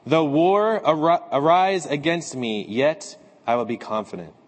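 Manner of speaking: usual speaking voice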